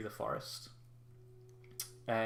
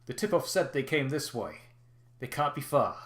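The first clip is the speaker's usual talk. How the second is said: louder barked orders to troops; commanding soldier; 3 semitones higher, 14 dB louder than usual. Acted. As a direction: order the search party. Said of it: "you hear a voice quite clearly say".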